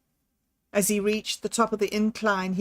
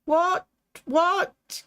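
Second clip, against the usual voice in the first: higher, halting shocked stammer; stuttering; 9 semitones higher than usual. Acted. Jack stammers at the grieving tennis group, higher and halting. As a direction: stumble over the words in shock. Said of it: "he stuttered".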